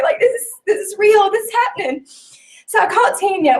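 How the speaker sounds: high, tear-choked voice; talking through tears; emotional, voice breaking; teary retelling. Real, not acted. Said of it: "and I was just crying".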